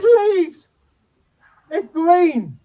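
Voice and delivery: high, hammed-up weepy amazement; mock tearful. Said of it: "and I'm crying".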